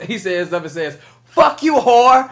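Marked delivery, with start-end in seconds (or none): 1.3-2.3 put-on loud, high, drawn-out kid's curse; mock angry yell. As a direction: imitate a bratty boy yelling abuse. Acting the boy cursing a woman.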